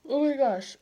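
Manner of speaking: words pulled long by a yawn. Real, not acted. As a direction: say it mid-yawn. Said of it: "I have the yawn so badly".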